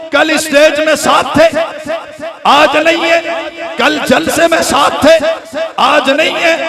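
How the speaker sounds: rhythmic, rising sermon cadence; fervent preaching. Acted. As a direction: drive the repetitions home. Preacher building to full force. (जोशीली तक़रीर)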